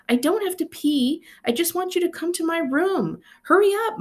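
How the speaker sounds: put-on an eager four-year-old begging his sister; small brother pleading to hurry; impatient little boy. A narrator's reading. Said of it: "Michael said".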